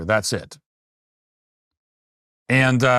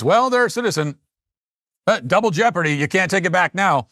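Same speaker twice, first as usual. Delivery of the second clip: mocking quote; 5 semitones higher than usual. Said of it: "And our attitude is".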